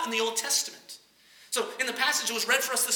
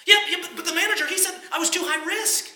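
high, louder, tumbling over words; flustered protest; 8 semitones higher, 5 dB louder than usual. Acted. Customer to bank president, high flustered objection. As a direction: sputter it out in disbelief.

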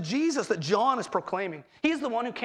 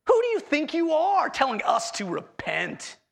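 raised, louder, scornful rebuke; indignant challenge; 6 semitones higher, 4 dB louder than usual. Retold or imitated. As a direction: scoff at the upstart prophet. Religious elite rebuking John.

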